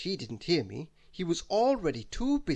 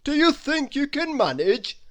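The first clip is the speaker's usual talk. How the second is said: Father Christmas asking; 11 semitones higher, 9 dB louder than usual. Acted. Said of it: "asked my famous uncle".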